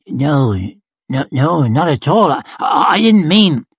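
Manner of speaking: stammered, rising denial; nervous backpedalling. Acted. Robin denies any insult to Yon, nervous stammer. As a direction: trip over the words placatingly.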